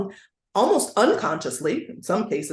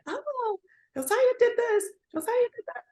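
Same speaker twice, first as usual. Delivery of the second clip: put-on very high little-kid tattle; singled-out accusation in a high child's voice; tattling children. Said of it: "the children did start to say it".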